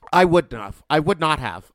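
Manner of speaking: loud, emphatic insistence; yelling. Real, not acted. Podcaster insisting they wouldn't have come back, yelling. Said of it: "I'm yelling".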